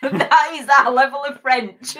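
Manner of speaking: cracking up while speaking; laughing. Real, not acted. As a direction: laugh through the words. Laughing at their poor French.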